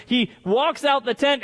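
ordinary delivery

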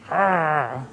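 lightly growled low arr like a wolf; a hint of a wolf's growl. Shown as a demonstration. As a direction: growl like a wolf, half-joking. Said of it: "That's me growling like a wolf".

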